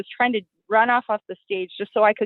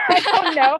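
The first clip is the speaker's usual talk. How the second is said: laughing; 12 semitones higher, 5 dB louder than usual. Real, not acted. Laughing after joke about husband.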